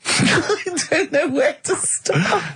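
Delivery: high, slow, through laughter; helpless giggling disbelief; laughing. Real, not acted. Laughing at homeless monkey.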